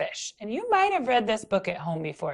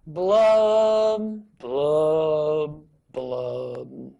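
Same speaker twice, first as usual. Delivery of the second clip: falling blub; 4 semitones lower, 4 dB louder, much slower than usual. Shown as a demonstration. Teacher leading kids in the droopy blub. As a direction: drop each blub down the scale. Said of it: "the part I need your help with".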